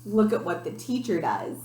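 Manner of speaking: ordinary delivery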